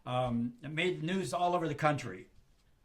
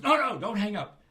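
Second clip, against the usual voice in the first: higher, louder don't hang up; mock frantic begging; urgent plea; 6 semitones higher, 8 dB louder than usual. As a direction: cut him off before he hangs up. Paul begs on the phone.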